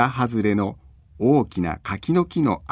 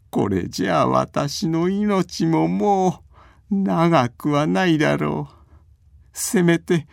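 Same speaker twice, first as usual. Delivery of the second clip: put-on higher, slow sickly old man; frail old man voice; 7 semitones higher, slower than usual. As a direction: sigh it out like a bedridden grandpa. Bedridden old man's lament.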